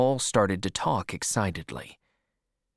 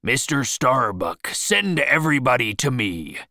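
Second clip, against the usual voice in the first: commanding shout, a little raised; 2 semitones higher, 4 dB louder than usual. Acted. Captain to his mate, summoning the crew, shouted. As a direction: call out an order across the deck. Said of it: "he shouted".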